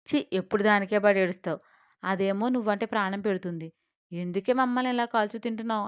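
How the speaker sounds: plain reading voice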